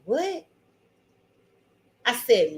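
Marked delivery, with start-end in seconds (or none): 0.0-0.4 retold surprise. Retells startled man's reply when woken.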